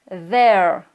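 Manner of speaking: tongue between teeth, hum the TH of there; vibrating TH. Shown as a demonstration. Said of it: "qui va vibrer un petit peu dans la gorge". (there avec un TH sonore, appuyé)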